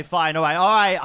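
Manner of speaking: ordinary talk, nothing marked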